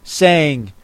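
plain reading voice

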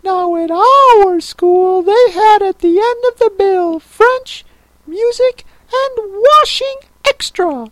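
very high, wailing turtle voice; plaintive Mock Turtle. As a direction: recount it in a squeaky, weepy warble.

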